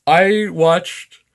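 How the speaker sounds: words stretched through a yawn. Real, not acted. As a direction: talk while yawning. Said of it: "Sorry for the yawn".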